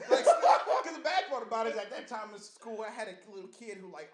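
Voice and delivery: normal talking voice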